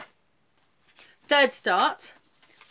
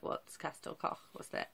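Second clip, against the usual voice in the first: puzzled outsider; 5 semitones lower, 15 dB quieter than usual. Retold or imitated. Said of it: "people who don't know Wales".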